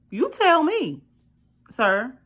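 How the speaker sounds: high, loud sarcastic snap; sassy retort. Retold or imitated. Firing back at her husband, sharp and sassy.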